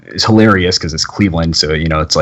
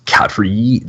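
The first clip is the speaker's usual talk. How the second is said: ordinary talk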